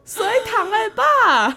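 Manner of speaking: high, loud protest at dad; laughing half-cry of protest; exasperated cry. Real, not acted. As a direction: shriek at dad in disbelief. Protesting dad's absurd compliment.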